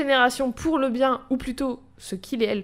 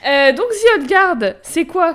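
laughing; 7 semitones higher, 9 dB louder, slower than usual. Real, not acted. Laughing after her own joke.

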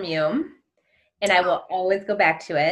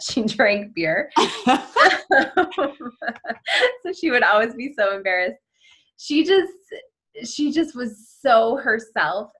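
laughing; 4 semitones higher than usual. Real, not acted. Laughing about grandma story.

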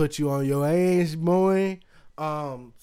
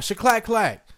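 yelled out; 8 semitones higher, 5 dB louder than usual. Retold or imitated. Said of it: "my mom yells out".

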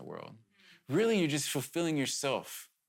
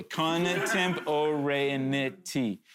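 Contemporaneity drawn out syllable by syllable at half speed; said really slowly; much slower than usual. Shown as a demonstration. Pastor teaching the congregation a new word, very slow and deliberate. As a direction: sound out Contemporaneity slowly and carefully, each syllable apart. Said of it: "I'm gonna say it really slowly and awkwardly".

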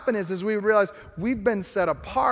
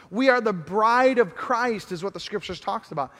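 normal talking voice

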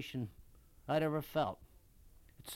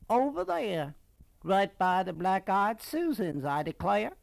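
grand plantation lady's Southern exclamation; increasingly Southern lady; 4 semitones higher, 6 dB louder than usual. A narrator's reading. Lady pointing to the flowers, thick Southern accent. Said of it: "her voice becoming more southern".

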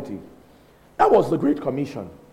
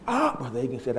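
normal talking voice

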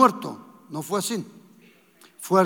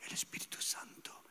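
soft intimate whisper, much quieter; whispered preaching; 18 dB quieter than usual. Acted. Preacher whispering to the congregation that the Spirit loves silence. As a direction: lean in and whisper like a secret.

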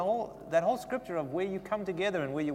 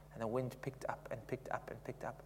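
hushed; 4 semitones lower, 12 dB quieter than usual. Retold or imitated. Wind builds during story.

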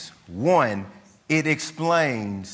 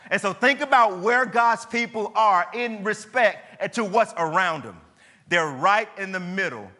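rising, fervent preaching voice, higher and building; 7 semitones higher than usual. Acted. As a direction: drive it home to the congregation. Builds the map of enemies.

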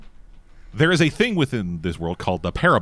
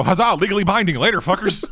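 higher, louder triumphant cry, breaking into laughter; gleeful mock proclamation; 10 semitones higher, 4 dB louder than usual. Player springs a surprise marriage on the table, gleeful mock proclamation. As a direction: crow it like a prankster making a getaway. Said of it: "And then go".

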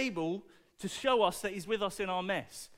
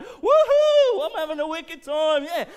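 high whooping party voice, much louder; partying joy; 7 semitones higher, 11 dB louder than usual. Acted. Trinity voiced partying happily.

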